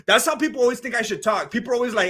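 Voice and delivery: usual speaking voice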